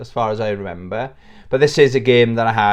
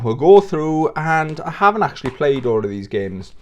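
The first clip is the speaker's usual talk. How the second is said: normal talking voice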